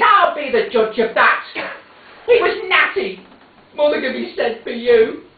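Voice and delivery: put-on high, haughty old mother; sharp retort.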